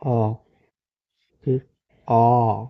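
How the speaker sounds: short "अ", then long drawn-out "आ"; teacher isolating "अ" and "आ"; vowel sound demo. Shown as a demonstration. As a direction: model "अ" and stretched "आ" as separate vowels. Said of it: "इस तरीके से पढ़ सकते हैं".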